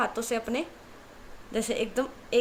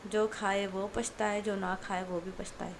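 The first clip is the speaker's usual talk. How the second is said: drawn-out, softer recital of a saying; slow proverb quote; 2 semitones lower, 7 dB quieter, slower than usual. A narrator's reading. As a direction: savour the proverb slowly, resigned. Quoting a proverb wistfully. (धीरे-धीरे, हारकर कहावत सुनाना)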